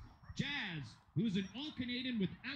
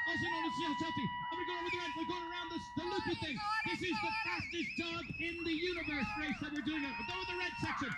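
raised, lively commentator voice; live play-by-play; 8 semitones higher than usual. Acted. Announcer calling a dog agility run, lively play-by-play. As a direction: call the run as it happens.

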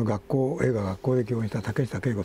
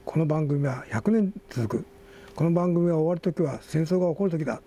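higher, steadier reading voice than in chat; article read-aloud; 6 semitones higher than usual. Real, not acted. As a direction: read the tribute out to the listeners.

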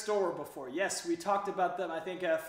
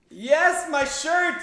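high, loud burst of delight; light up, thrilled someone noticed; happy shout of recognition; excited; 8 semitones higher, 8 dB louder, much slower than usual. Real, not acted. Viewer noticed his shirt.

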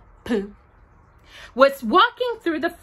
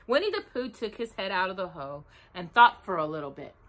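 ordinary delivery

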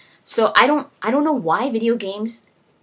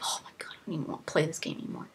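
rushed, whispered under breath; fed-up whisper; 5 semitones lower, 14 dB quieter, much faster than usual. Retold or imitated. Gamer whispering disgust at bad voice acting. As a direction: mutter it fast like you've given up. Player fed up, muttered aside.

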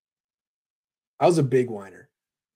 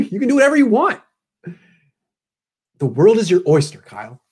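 ordinary delivery

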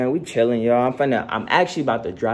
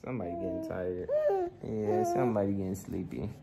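soft soothing; 6 dB quieter than usual. Real, not acted. Soothing sleepy baby.

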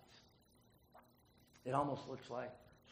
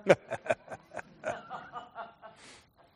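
high, loud burst of laughter; real laugh; 10 semitones higher, 10 dB louder than usual. Real, not acted. Speaker laughing at his own joke, loud laugh. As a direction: laugh at your own punchline.

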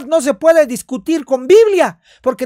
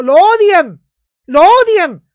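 loud emphatic; 6 semitones higher, 7 dB louder, slower than usual. Real, not acted. Preacher stresses demons hate.

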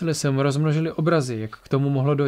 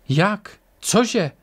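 higher-pitched baffled questions; startled disbelief; 6 semitones higher than usual. A narrator's reading. Attacker told he knows nothing, startled. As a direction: blurt it out, pitch jumping up. Rattled fighter asks in disbelief.

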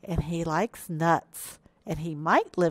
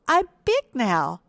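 higher, louder little hippo boast; proud cry; 5 semitones higher, 5 dB louder than usual. A narrator's reading. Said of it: "cried Little Hippo".

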